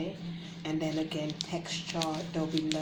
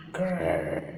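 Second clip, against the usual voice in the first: tiger growl; 2 semitones higher, 6 dB louder than usual. Shown as a demonstration. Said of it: "That's a tiger sound".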